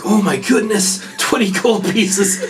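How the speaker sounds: high, slow wonder; amazed delight. Acted. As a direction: draw it out, astonished by the windfall. Elf amazed at big payment.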